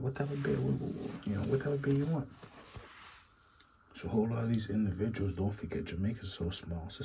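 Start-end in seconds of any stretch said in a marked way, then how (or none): 0.0-2.3 mock servant. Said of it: "Imagine that".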